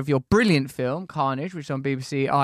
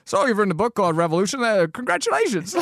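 brisk talk-show host congratulating without caring; imitated breezy indifference; 4 semitones higher than usual. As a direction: toss off hollow, quick congratulations as a mock host. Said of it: "It's utterly indifferent".

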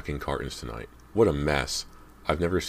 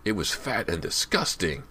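high-pitched, excited disgust; excited exclamation; 6 semitones higher than usual. A narrator's reading. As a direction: exclaim it like a worked-up kid. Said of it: "James exclaimed excitedly".

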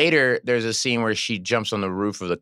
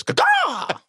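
very high squawking cry; imitated headbutt cry; 3 dB louder than usual. Actor headbutts someone to make crew laugh, silly squawk. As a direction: squawk it out like a bird. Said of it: "like that".

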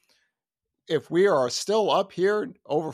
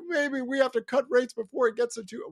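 Goolsby; 7 semitones higher than usual. Retold or imitated. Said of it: "Goolsby came out today. He's like".

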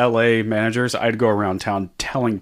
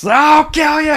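high screaming death threat; berserk rage; 8 dB louder, slower than usual. Retold or imitated. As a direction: scream it like a wild attacker. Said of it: "it got even worse".

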